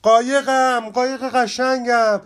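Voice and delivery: high, loud cry for the lost boat. Acted. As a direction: yell after the boat being swept away. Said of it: "نارنجی فریاد کشید".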